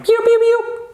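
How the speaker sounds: very high little cheeps; chick peeping. Pretend. Teacher acting out chicken sounds, chick peeping. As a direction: peep like a baby chick.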